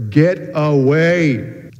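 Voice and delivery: a drawn-out, hammed-up cry of fright; retold terrified yell. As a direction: reenact shouting at the window-knockers in the dark. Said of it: "I would say I yelled out in terror".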